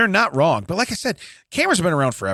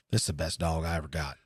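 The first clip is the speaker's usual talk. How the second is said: hillbilly voice; 10 semitones lower, 12 dB quieter than usual. Retold or imitated. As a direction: voice a mumbling backwoods owner.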